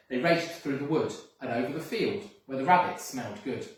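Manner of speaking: ordinary delivery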